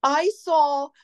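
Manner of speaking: teary member. Retold or imitated.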